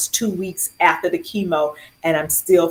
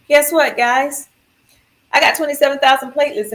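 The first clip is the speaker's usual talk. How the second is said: higher, fast, chirpy announcement; clueless cheer; 7 semitones higher, 3 dB louder than usual. Retold or imitated. Patient cheerfully tells the nurses her platelet count, excited and clueless. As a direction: announce it like good news, not knowing it's bad.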